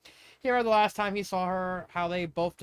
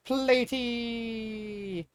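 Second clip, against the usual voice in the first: excited greeting; 5 semitones higher than usual. Acted. Pearl hugs her cousin.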